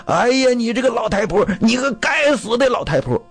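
high-pitched angry tirade at the wife; angry scolding. Acted. Cornered husband takes his temper out on his old wife, angry scolding. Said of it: "骂老太太".

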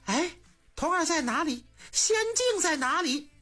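high, urgent cries searching for someone; anxious calling out. A narrator's reading. Empress Dowager searching for the vanished boy, anxious high calls. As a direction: call out anxiously, voice climbing high. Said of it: "西太后着急了".

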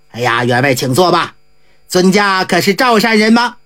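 low, easygoing monk voice; a casual old monk offering a seat; Jigong's voice. A narrator's reading. Monk Jigong inviting the squire to sit, low and casual.

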